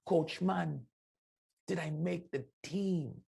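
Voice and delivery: high, slow pleading voice; a young player's pleading question; hopeful pleading player. Retold or imitated. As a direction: ask like a hopeful kid. Player with puppy dog eyes.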